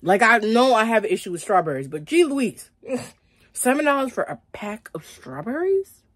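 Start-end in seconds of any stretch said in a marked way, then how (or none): 2.1-6.0 high, laughing disbelief; exasperated disbelief. Real, not acted. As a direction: squeal it out in disbelief. Mock outrage at prices.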